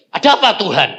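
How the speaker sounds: high, loud retold start; startled reply. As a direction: answer as if jolted from sleep. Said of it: "Kaget aku".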